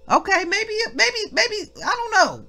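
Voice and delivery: very high-pitched feigned uncertainty; mock doubt. Preacher playfully doubting own reading before the congregation, high mock doubt. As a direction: squeak out a pretend second-guess.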